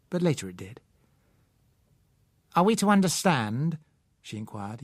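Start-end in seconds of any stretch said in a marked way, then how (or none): 2.5-3.8 hard, cold, cutting question, a touch of steel; a hint of an icy, unbending woman; carbon steel voice. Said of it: "using a kind of carbon steel voice".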